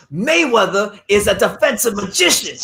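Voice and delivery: slow, grand pundit line; slow mock-grand quote; quoting the pundit. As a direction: savour each word like a TV pundit. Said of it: "my favorite thing he says".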